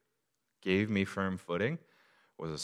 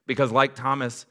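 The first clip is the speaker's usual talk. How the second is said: rising, louder preacher build, slowing on the list; preaching; 5 semitones higher, 5 dB louder than usual. Acted. Pastor preaching to the congregation, building. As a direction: lift it and press each line home.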